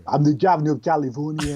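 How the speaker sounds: put-on quick, Austrian action-star accent; a playful Schwarzenegger Austrian accent; a light Austrian Schwarzenegger accent; Arnold Schwarzenegger impression. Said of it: "Arnold Schwarzenegger is really fun to do".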